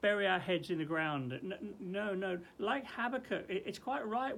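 ordinary talk, nothing marked

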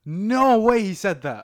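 loud, slow, stunned; shocked disbelief. Real, not acted. Shocked reaction to news.

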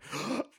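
high, quick intake of breath; soft pretend shocked gasp; mock gasp. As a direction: imitate an offended spouse's gasp. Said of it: "she's gonna listen to this and be like".

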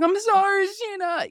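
high, whiny fake-crying apology; mock Sandoval crying. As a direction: ham up his tearful sorry. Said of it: "I love your Sandoval impressions".

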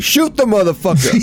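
high-pitched comic outburst; imagined shouted order. Acted. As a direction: yell it as an imagined character's order. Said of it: "he'd be like".

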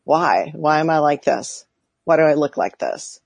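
ordinary talk

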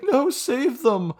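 high, slow mock plea; hammed-up high plea of a grieving family; mock pleading family. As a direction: wail it like a desperate relative.

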